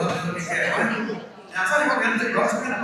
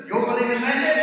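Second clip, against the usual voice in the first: louder emphatic ask; 5 semitones higher, 6 dB louder, faster than usual. Shown as a demonstration. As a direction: ask it again, louder and more rousing. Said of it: "It's not very emphatic, is it?".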